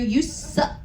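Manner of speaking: ordinary delivery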